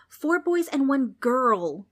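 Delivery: put-on a girl correcting the ghost, miffed. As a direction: correct the ghost firmly, annoyed. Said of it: "getting miffed at the ghost's mistake".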